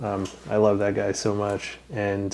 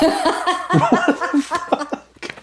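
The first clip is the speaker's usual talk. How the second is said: high, drawn-out "What the fuck?" through laughter; laughing disbelief; 3 dB louder, much slower than usual. Retold or imitated. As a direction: stretch it out, cracking up in shock. Friend pulls gun from pants.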